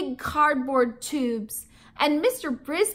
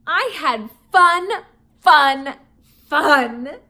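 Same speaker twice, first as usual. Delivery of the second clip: louder, bursting with joy; excited hamster cheer; gleeful; 10 dB louder, slower than usual. Acted. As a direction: shout each fun with delight.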